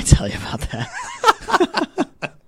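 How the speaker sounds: laughing. Real, not acted. Laughing off a joke about field trips.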